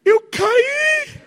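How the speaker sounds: very high, mock tearful whine; crying child. Boy answering mother after a fall, hammed-up crying. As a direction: squeal it through put-on sobs. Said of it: "Aí ele chorando diz".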